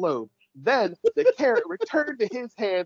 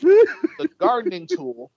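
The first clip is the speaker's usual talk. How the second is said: laughing; 12 semitones higher, 6 dB louder, slower than usual. Real, not acted. Laughs at the absurd scene.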